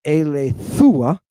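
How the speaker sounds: "alethua" as ay-lay-THOO-ah, no W glide; a long oo after the th in "alethua"; wrong pronunciation. Shown as a demonstration. Teacher showing another mistake, "alethua" ending THOO-ah. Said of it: "It's not aletheia".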